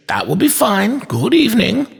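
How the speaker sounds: high, crisply polite goodbye; dismissive toy maker. A narrator's reading. Toy maker sees inspector out, dismissive. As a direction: pitch it up and close the matter.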